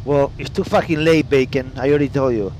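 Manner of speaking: ordinary talk, nothing marked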